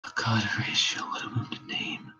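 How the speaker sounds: faint breathy whisper; dying whisper. Acted. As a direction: barely breathe the words out.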